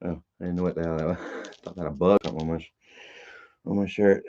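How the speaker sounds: ordinary delivery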